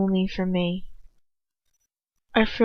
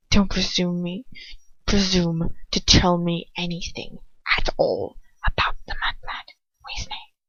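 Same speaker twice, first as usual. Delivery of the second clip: soft menacing hiss; malicious murmur; 2 semitones lower, 3 dB quieter than usual. A narrator's reading. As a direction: threaten him in a hush. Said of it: "he murmurs maliciously".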